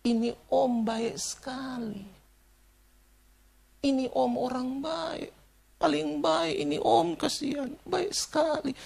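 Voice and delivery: high, drawn-out mock weeping; retold sobbing lament, pitched high; tearful, imitated. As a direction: imitate a mourner wailing slowly through tears. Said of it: "dalam tangisannya itu dia bilang begini".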